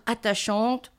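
usual speaking voice

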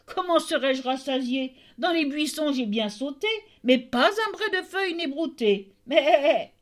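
high, sing-song mocking goat, jumping up on the last line; a spiteful goat lying to the tailor; wicked goat. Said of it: "la méchante bête lui répondit". (chèvre méchante et moqueuse, voix aiguë)

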